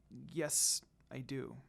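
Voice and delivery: quiet admission with a sigh; murmured. A narrator's reading. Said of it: "she murmured".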